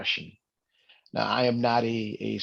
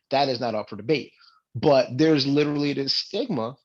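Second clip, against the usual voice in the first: ordinary delivery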